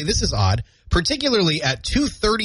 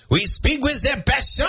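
high, passionate mock French cry; hammed-up Parisian French accent; France French accent; 9 semitones higher than usual. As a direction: declaim it in an exaggerated French accent. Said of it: "that's more of a France thing".

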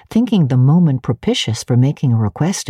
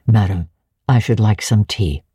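lowered curt master; 6 semitones lower than usual. A narrator's reading. Rochester curtly asks tea.

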